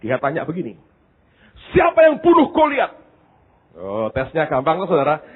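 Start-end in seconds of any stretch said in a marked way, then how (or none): 1.7-2.9 high, loud angry pastor quiz; stern pastor. Retold or imitated. Pastor grilling a frightened student, angry and loud. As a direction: bark the question like an irritated pastor.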